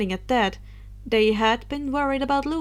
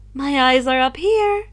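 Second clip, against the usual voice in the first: choked; 2 semitones higher, 7 dB louder than usual. Acted. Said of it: "she choked".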